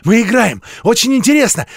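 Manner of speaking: put-on high, loud, eager little girl; a child thrilled by her game; excited child. A narrator's reading. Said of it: "Запыхавшись, вся красная, отозвалась мышка".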